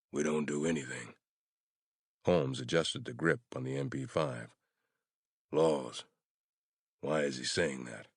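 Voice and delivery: plain reading voice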